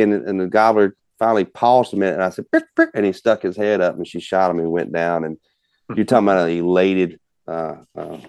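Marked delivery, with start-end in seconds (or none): 2.5-2.9 very high-pitched imitated bird cluck; two quick high turkey clucks. Hunter clucking so the gobbler sticks his head up, turkey cluck. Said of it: "that cluck thing".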